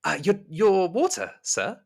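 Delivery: high, careful servant's voice; Rosemary. Acted. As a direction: offer it politely in a high, deferential voice. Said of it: "Rosemary is just stood there next to you".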